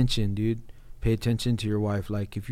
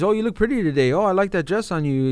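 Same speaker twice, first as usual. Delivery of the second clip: mock sweet compliment; 8 semitones higher, 5 dB louder, faster than usual. Husband's calculated compliments.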